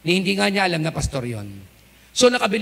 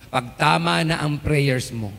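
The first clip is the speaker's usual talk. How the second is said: preaching call; 6 semitones lower, 3 dB louder than usual. Acted.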